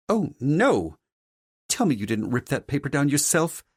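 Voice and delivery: high-pitched, spoiled mother in dismay; appalled mother character. Acted. Mother learns her son did manual labor, appalled high character voice. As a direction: fuss in high-voiced horror.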